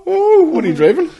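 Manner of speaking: high, loud mock-impressed question; mock-dazzled eagerness; teasing excitement. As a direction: gush over the new car to wind him up. Teasing the new car owner.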